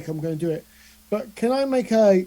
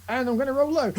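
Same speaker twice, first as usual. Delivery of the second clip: ordinary talk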